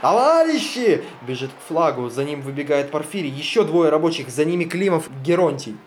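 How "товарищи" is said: high, slow rallying cry; rally the workers with a long shout. Acted. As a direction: worker calling comrades to the flag, rallying shout. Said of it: "кричит".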